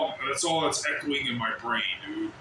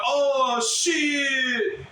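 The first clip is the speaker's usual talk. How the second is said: high, loud mock "Oh, shit"; mocking shocked yell; 7 semitones higher, 3 dB louder than usual. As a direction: mimic the crowd's shocked cry. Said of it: "Nobody went".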